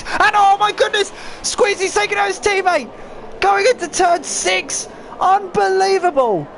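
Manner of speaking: shocked high shouting race call; excited. Real, not acted. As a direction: erupt at the crash in disbelief. Teammates crash, commentator erupts.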